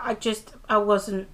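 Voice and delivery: ordinary talk, nothing marked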